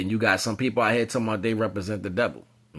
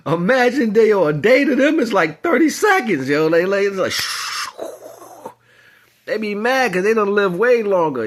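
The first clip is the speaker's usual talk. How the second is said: much higher, louder excited rant; animated; 9 semitones higher, 5 dB louder than usual. Real, not acted. Telling listeners about beings with long days, excited and loud. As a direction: get worked up as it builds.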